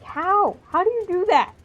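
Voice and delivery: high, loud, incredulous question; amazed disbelief. Real, not acted. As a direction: burst out marvelling at his range. Marvels at actor's vocal range.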